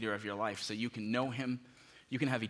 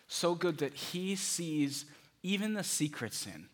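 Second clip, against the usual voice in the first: slow, stretched emphasis on even and secret sin; drawn-out emphasis; 6 semitones higher, slower than usual. Real, not acted. Preacher to congregation, slow emphatic stress. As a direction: lean hard into each word, slowly.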